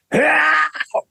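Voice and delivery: high, full-out fighting yell; battle scream. Shown as a demonstration. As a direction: let out a sustained attack scream. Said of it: "this line has gotta be screamed at someone".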